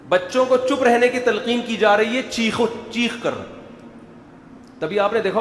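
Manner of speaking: ordinary talk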